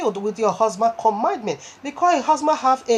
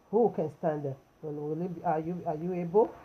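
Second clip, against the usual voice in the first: low, very soft rhetorical question; hushed questioning; 4 semitones lower, 10 dB quieter than usual. Real, not acted. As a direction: drop right down low and soft. Rhetorical question repeated to the listeners.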